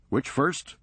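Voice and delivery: brisk, sardonic comeback; wry Serge. Acted. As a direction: toss it off dryly, quick.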